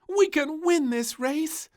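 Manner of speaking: high, loud, slow racing cheer; excited princess. A narrator's reading. Princess racing the donkeys, loud high. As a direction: urge them to the finish.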